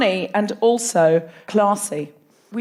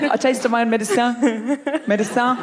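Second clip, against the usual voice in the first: English words in a put-on French accent; flirty French-accented echo. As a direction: mimic a French speaker, playfully. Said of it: "English words with a French accent".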